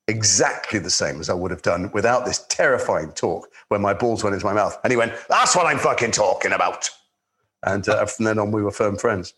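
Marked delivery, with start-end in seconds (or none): 5.3-6.9 tough director's approval. Retold or imitated. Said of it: "He was a very tough, irascible".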